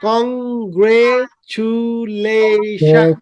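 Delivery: "Congratulation" very slow, five long syllables. Shown as a demonstration. Teacher counts syllables for students, "congratulation" slowly broken up. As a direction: draw out every syllable of "congratulation". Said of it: "পাঁচটা syllable".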